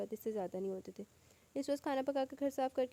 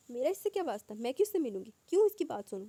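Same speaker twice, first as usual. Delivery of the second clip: irritated outburst; 3 semitones higher, 8 dB louder than usual. A narrator's reading. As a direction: snap back, getting louder each line. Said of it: "وہ تنک کر بولی". (تنک کر بلند ہوتی آواز)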